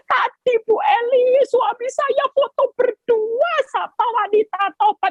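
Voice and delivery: high, wailing, tearful plea to the pastor; mock crying complaint. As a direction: whine it out like a weeping wife. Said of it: "ibu-ibu nggak usah nangis".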